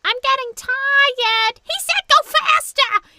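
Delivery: very high, loud, slow cartoon mouse voice; squeaky mice. Retelling the Muppet mice on skis, very high squeaky mouse voice. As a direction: squeak it out like a tiny skiing mouse. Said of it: "and they were like".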